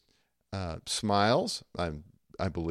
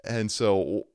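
ordinary talk

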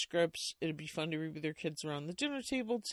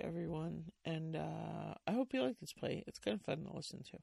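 ordinary delivery, nothing marked

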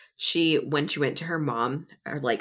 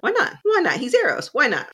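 mock high whine; 11 semitones higher, 3 dB louder than usual. Eros voiced pleading, retold.

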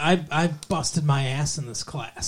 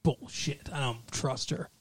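snide mutter; 13 dB quieter, slower than usual. Retold or imitated. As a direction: mutter it under your breath. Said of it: "Kelly's like".

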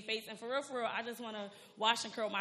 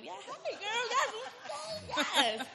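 excited; 6 dB louder than usual. Real, not acted. Excited greeting to hosts.

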